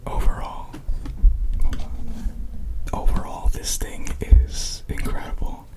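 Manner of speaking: soft whispered review; gentle ASMR outro whisper. Real, not acted. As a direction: whisper the wrap-up close to the mic.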